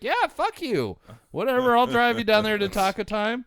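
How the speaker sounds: high, fast mock teenage sneer; dismissive teen sitter. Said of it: "and she's like".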